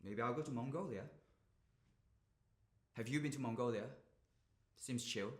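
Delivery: very soft, low and withdrawn; deflated, near-murmured small talk; resigned confiding. Acted. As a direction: wind down quietly, spent. Resigned confiding to silent listener.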